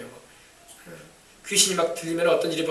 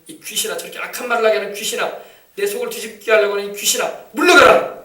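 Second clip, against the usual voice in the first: rebuking the demon; 2 semitones higher, 4 dB louder than usual. Shown as a demonstration. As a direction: command it to leave, climaxing. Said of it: "대적하시기 바랍니다". (물러가라에서 터지는 호령)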